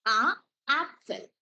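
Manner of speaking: slow "ആ, ആപ്പിൾ" with an open aa; drawn-out "ആ" then "ആപ്പിൾ" German style; German letter A. Shown as a demonstration. Teacher shows students how German A sounds, slowly. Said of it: "ഇത് pronounce ചെയ്യുന്നത് ആ".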